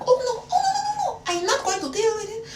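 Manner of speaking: very high-pitched mock French protest; put-on French accent. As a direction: refuse in a squealing comic French accent. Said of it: "I still had the accent".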